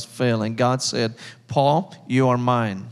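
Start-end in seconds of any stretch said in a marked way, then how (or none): none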